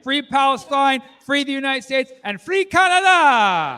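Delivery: high, loud chant, slowing at the end; rally the crowd; real rallying shout. Real, not acted. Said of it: "shouted closing list".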